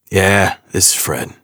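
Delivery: slow gruff New York answer; a hint of a New York tough guy; gruff New York accent. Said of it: "gruff New York accent".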